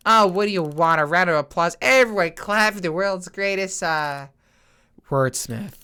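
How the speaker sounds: exasperated, jeering fanfare; sarcastic mock praise. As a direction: heap on sarcastic applause. Character mockingly offers applause.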